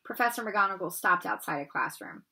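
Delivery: ordinary delivery, nothing marked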